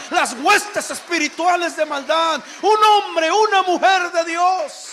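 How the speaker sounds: loud, rising sermon climax; fiery preaching. Acted. Pastor rousing the church to courage, at full force. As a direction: preach it out, building to a roar.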